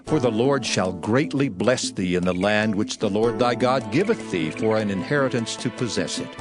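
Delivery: normal talking voice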